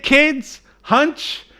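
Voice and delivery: usual speaking voice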